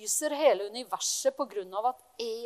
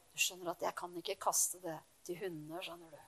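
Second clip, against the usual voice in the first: much softer and lower, kindly; gentle explanation; 9 semitones lower, 13 dB quieter than usual. Pretend. Jesus gently explaining to the woman: soft, kind tone. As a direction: explain it softly, as if with a smile. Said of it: "Han kan ha sagt det sånn".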